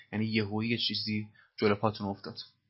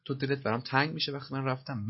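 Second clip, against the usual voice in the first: normal talking voice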